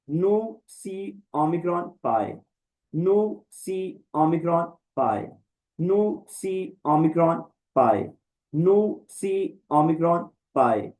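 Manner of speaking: slow, evenly spaced letter names; slow recitation drill. Shown as a demonstration. Teacher drilling Greek letters with learners, slow recitation. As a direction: recite each name separately, falling at the end.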